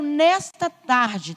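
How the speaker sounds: ordinary talk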